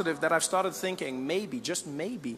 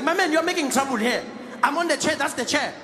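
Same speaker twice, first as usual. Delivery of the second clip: much higher, insistent plane neighbour; mock indignant neighbour; 7 semitones higher than usual. Neighbour telling the Australian to move, high mock insistence. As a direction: press him to just go sit there. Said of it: "he's like".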